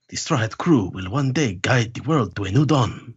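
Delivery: slow, hammed-up Spanish accent; measured Spanish-accented prophecy; Antonio Banderas. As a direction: put on a suave Spanish film-star accent. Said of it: "hear more Antonio Banderas".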